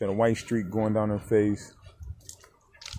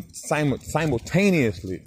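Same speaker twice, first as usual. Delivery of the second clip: simultaneously stretched out slowly and pitched higher; drawn-out emphasis; 4 semitones higher, slower than usual. Shown as a demonstration. Telling the crowd how the pups let go, drawn-out emphasis. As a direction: lean hard on simultaneously and draw out every syllable. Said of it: "ladies and gentlemen".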